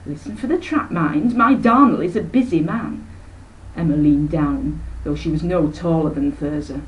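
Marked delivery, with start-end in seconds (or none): 0.1-3.0 high, bright-voiced busy aunt rattling on; a breezy society lady who never stops talking; breezy chattering aunt. Aunt Emma chatters to her sister, airy and high.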